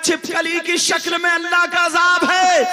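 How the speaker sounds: loud, driving sermon over music; fiery preaching. Acted. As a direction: thunder the warning at the congregation. Preaching at full force.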